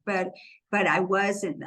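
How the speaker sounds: ordinary talk, nothing marked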